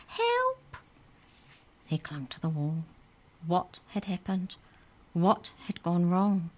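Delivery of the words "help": very high mocking squeaked help; mock squeal. Kids mocking the boy's fall, very high mock squeal. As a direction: pretend to panic to tease him. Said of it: "others pretending to squeal".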